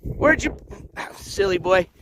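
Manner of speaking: ordinary talk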